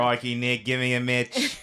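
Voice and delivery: put-on over-the-top Australian accent, breaking into laughter; hammed-up Australian accent. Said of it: "She doesn't sound anything like that".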